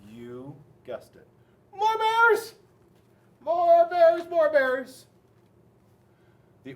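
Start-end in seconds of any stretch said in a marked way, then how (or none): 1.7-5.0 high, loud repeated chant; high-pitched kids' clamour; children yelling. A narrator's reading. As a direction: yell it like a crowd of kids demanding more. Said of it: "the boys and girls yelled".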